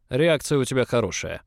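lightly voiced overbearing director; boss's grudging praise. A narrator's reading. Boss sprawled in chair praises newcomer, loud domineering voice. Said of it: "неожиданно похвалил меня уволень".

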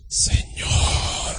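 long, soft whispered invocation; affected praying voice. Retold or imitated. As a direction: exaggerate a hushed, drawn-out plea to God. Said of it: "no, no, no, te decía".

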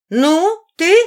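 high, loud, rising prod; insistent. A narrator's reading. Сильвана pressing the silent heroine, insistent. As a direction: press her for the answer.

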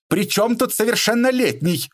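very high indignant outcry; exasperated protest. A narrator's reading. Mother objecting that her son is still a child, high exasperated protest. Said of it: "всплеснула руками Геля".

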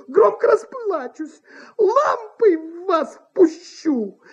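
high, frantic, indignant actress; exasperated threat. A narrator's reading. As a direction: drive him out, on the verge of tears. Actress threatens to scream, cry.

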